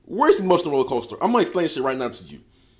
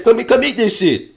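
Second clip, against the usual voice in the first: higher and louder, a mock insistent order; pushier, higher demand; 6 semitones higher, 5 dB louder than usual. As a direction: girlfriend in an argument, louder and pushier. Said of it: "she'll say".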